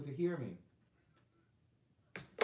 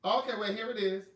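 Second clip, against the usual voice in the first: excited find; 7 semitones higher, 8 dB louder than usual. Real, not acted. Finds the tracking info.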